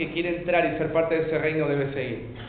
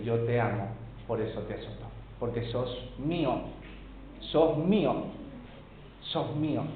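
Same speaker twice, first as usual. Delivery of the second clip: soft, low voice of a loving Father; hushed, pausing between repeated phrases; tender reassurance; 4 semitones lower, 7 dB quieter than usual. Acted. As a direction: reassure quietly, as God to a weeping child. Voices God reassuring a weeping child.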